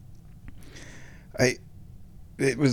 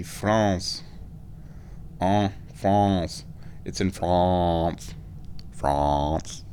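put-on drawn-out, playful French accent on "France"; 4 semitones lower, 4 dB quieter, much slower than usual. Vlogger toying with the World Cup host's name, French accent. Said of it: "That's a pretty good French accent".

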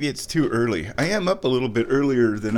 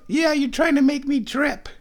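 very high pet-talk voice; 14 semitones higher, 3 dB louder than usual. Real, not acted. Mock-scolding the cat in baby talk.

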